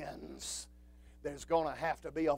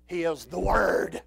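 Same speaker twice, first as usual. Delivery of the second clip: ordinary talk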